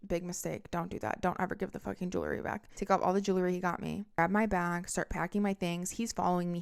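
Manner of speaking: ordinary talk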